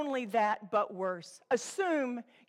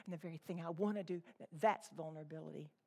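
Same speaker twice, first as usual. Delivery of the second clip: much softer, lower, hushed confession; quiet quote; 7 semitones lower, 12 dB quieter, faster than usual. Retold or imitated. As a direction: say it low like Paul admitting weakness. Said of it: "He says".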